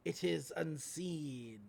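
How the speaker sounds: slow, drawn-out pronouncement; lightly voiced cloaked figure. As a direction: intone it slowly, a hint of mysterious cultist. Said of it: "There's a third cloaked figure".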